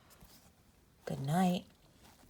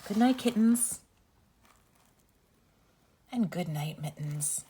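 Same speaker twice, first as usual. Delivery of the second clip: gentle sing-song bedtime read-aloud; reading to children. Acted. Reads bedtime story to children.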